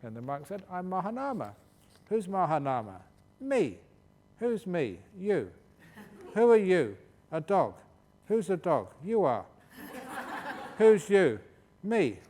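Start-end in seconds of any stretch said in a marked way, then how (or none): 0.7-5.5 loud, higher quick retorts with a laugh; playful debate. Retold or imitated. Monks trade quick retorts before villagers, loud and playful. 6.3-7.0 much louder, higher question; mock shouted challenge. Challenger monk shouts at Mahanama, loud and higher. 7.3-12.2 loud, higher retorts breaking into laughter; laughing debate. Retold or imitated. Monk tells the villagers' crowd he's a dog, laughing loudly.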